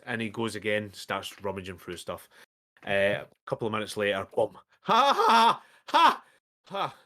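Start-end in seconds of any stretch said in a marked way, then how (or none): 4.8-6.9 loud high cackling laugh; triumphant laugh. Acted. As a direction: cackle with glee. Shopkeeper finds the deed.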